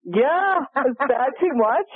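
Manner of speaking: high, excited exclamation; amazed delight. Real, not acted. Storyteller reacting to the listener's disbelief, in amazed delight. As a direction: exclaim it, thrilled by the coincidence.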